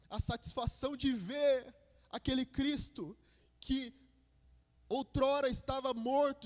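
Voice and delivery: high, loud preaching at full force; fervent preaching. Acted. Preacher to congregation on Easter night, full fervent preaching. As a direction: proclaim it with rising fire.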